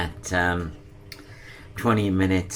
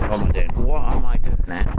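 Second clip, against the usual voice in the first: building mock exasperation; 3 semitones higher, 8 dB louder than usual. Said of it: "You're rambling".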